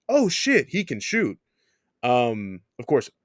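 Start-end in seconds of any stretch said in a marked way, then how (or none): 0.1-1.4 mock-panicked, high-pitched shout; imitated fearful shout. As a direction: imitate an opponent crying out in alarm. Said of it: "hearing the fear instilled in someone's voice with someone shouting".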